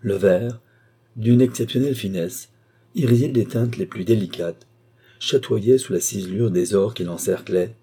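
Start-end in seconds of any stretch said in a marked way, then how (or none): none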